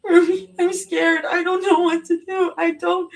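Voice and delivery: high, tearful frightened plea; cling to her friend crying; sobbing panic; crying fear. A narrator's reading.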